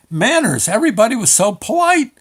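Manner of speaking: loud, high, incredulous delight; amazed. Real, not acted. Customer marveling at manners in the DMV, loud and amazed. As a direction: push it up high and loud in disbelief.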